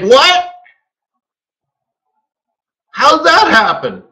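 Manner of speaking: high, rising disbelief; baffled. Retold or imitated. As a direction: act out the baffled "what?". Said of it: "And I'm like".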